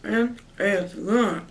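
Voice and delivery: finishing a bite mid-sentence; chewing while talking. Real, not acted. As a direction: mouth partly full of food. Seen 1: Food bitten at -9; jaw and lips still working during words.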